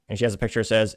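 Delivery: ordinary talk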